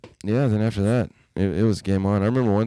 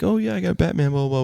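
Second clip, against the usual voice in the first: put-on kid voice; 9 semitones higher than usual. Young self voiced in story.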